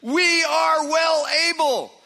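high, loud and bold rallying call; rally the people to take the land; voice of faith. Retold or imitated. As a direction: Caleb urging Israel forward, bold and loud. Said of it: "there's the voice of faith".

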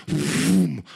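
loud imitated rushing whoosh; a sudden sweeping sound effect; whooshing vroom. Imitates presence sweeping through the room.

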